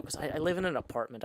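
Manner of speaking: ordinary talk, nothing marked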